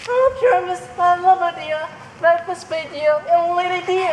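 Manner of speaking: put-on high, dainty girlish voice; tiny voice. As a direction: coo it like a tiny lady. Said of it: "I'll speak in a monstrous little voice".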